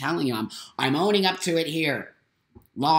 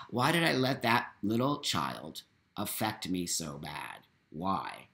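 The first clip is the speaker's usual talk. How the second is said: much softer and lower, wondering aloud; quiet self-question; 8 semitones lower, 8 dB quieter than usual. Retold or imitated. Asking herself after calming down, hushed and rueful. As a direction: ask yourself under your breath, deflated. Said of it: "when I calmed down ... I said".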